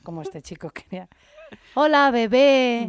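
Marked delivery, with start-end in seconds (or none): 1.8-2.9 high, loud, stretched put-on voice; mock sleazy greeting. Mocking how her ex greeted her, high and loud. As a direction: ham up a creepy flirt.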